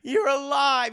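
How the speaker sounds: put-on wailing drunk mother, high and loud; overdone tearful wail of relief; mock crying. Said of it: "alcoholic of a mother is crying".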